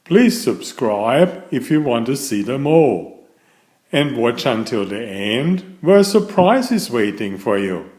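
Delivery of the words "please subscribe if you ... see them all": ordinary delivery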